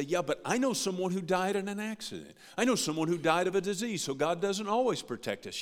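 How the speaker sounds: a hint of a sceptical churchgoer; doubting listener. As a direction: voice the listener pushing back. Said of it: "And you might say".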